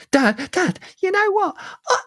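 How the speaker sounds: put-on very high-pitched little-boy voice; put-on falsetto child; kid voice. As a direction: squeak it out like a child pestering Dad. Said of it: "it's my only kid voice I've got".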